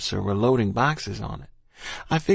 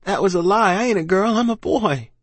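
high, louder frantic denial; crying out; 9 semitones higher, 5 dB louder than usual. A narrator's reading. Child fending off soldier, frantic cry. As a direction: protest high and urgently, like a child. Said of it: "I cried".

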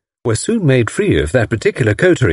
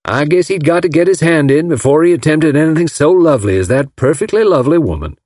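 put-on Southern American accent; 6 semitones higher than usual. A narrator's reading. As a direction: gush like a smitten Southern gentleman. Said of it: "Mister Ryder came from Alabama".

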